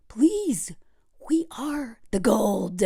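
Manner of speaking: slow, soft whisper; hushed plea. Real, not acted. Host urging women to know their worth, slow whisper. Hushed take, then repeated forcefully.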